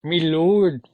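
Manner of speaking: loud hammed-up Middle English accent; booming olde English "me lord". Shown as a demonstration. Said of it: "I is E".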